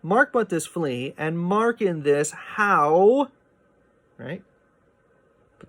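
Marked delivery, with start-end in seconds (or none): 0.0-3.3 "how" drawn out slow with a rising tune; reading through the line. Shown as a demonstration. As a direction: keep going on "how" without a stop. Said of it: "what you're actually reading is".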